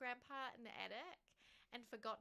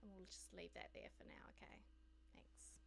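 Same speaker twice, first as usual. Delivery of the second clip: whisper, lower, as if sharing a secret; whispered aside; 7 semitones lower, 15 dB quieter than usual. Real, not acted. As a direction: hush it like a quick confidential aside. Quiet aside putting item away.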